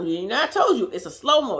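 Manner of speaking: ordinary talk, nothing marked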